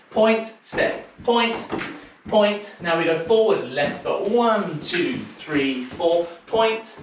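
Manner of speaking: rhythmic counting of steps, stretched words; dance step calling. Acted. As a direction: call each step on the beat like a dance teacher. Teacher calls dance routine.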